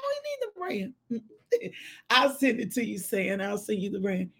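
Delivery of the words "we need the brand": high, soft, slow self-mimicry; mock squeal. Mimicking her own odd phrasing for viewers, slow high squeal. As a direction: stretch it out high and quiet. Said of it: "That's why I said like that".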